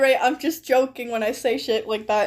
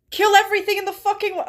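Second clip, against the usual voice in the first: rushed outburst; 6 semitones higher, much faster than usual. Real, not acted.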